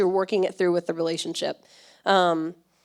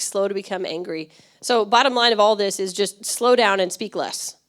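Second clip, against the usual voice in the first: normal talking voice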